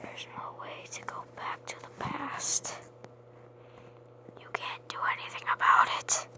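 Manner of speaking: eerie breathy whisper to freak viewers out; spooky whispered warning; creepy whisper. Pretend. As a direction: hush it like a ghost story.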